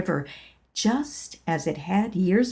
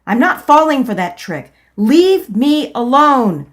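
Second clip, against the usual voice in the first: loud defiance; 5 semitones higher, 10 dB louder than usual. Acted. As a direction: stand your ground. Refusing inner voice.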